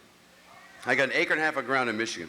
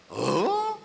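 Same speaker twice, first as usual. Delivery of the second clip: incredulous huh; 8 semitones higher than usual. Retold or imitated. Quarrel with aunt.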